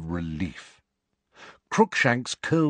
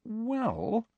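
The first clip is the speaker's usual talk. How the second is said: tentative; 5 dB quieter than usual. A narrator's reading. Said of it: "said Hermione tentatively".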